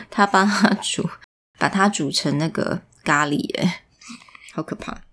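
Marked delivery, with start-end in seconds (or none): 4.5-5.0 hushed, scared aside; whisper. Real, not acted. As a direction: breathe it like a spooky secret.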